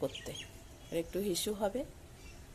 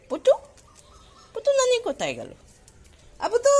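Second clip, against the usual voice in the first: loud, much higher call for the dog; 11 dB louder than usual. Real, not acted. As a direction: shout her name to find her. Searching and calling for the dog. (হারানো কুকুরকে জোরে উঁচু গলায় ডাকা)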